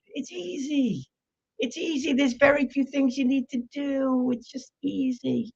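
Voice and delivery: high, soft sing-song reassurance; breezy pitch-man voice; mock easy. Mock sing-song selling ease.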